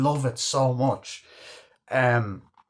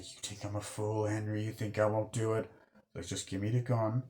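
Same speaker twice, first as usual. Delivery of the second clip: hushed standoff; 2 semitones lower, 11 dB quieter than usual. Retold or imitated. Couple in a standoff over a gun, hushed. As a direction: act out the tense exchange under your breath.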